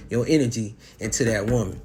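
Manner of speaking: ordinary delivery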